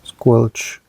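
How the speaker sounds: ordinary talk, nothing marked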